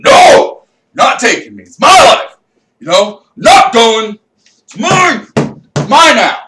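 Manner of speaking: high, loud desperate screams; screaming. Retold or imitated. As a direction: fight them off with frantic yells. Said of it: "I start screaming".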